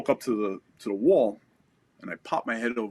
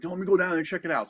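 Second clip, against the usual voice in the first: lightly imitated squad leader, higher and quick; retold urgent offer; 10 semitones higher, 3 dB louder, much faster than usual. Squad leader volunteering to check screams downstairs, retold urgency. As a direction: rush it out in a raised pitch.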